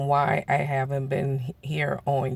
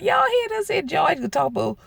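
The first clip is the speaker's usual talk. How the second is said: squealing joking indignation; high mock outrage; 6 semitones higher than usual. Telling the family what he said, high mock outrage. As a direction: play up fake offense, high.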